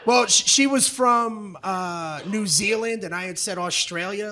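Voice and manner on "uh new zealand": normal talking voice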